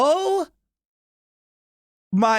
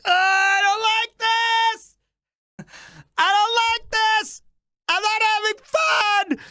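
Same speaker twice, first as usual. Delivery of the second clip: put-on high, whimpering, childlike whine; hammed-up pained whine, pitched way up; whiny high complaint; 12 semitones higher than usual. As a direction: whine it up high like a hurt kid.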